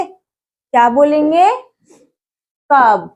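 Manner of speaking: normal talking voice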